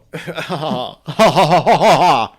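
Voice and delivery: high, loud drawn-out laugh. Real, not acted. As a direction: burst into a long ringing laugh. Loud performed laugh at compliment.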